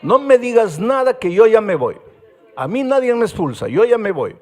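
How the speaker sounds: loud, high defiant yell; boy refusing to be expelled, shouting. Retold or imitated. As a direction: throw the words back, proud and angry. Rebellious boy defying the pastor.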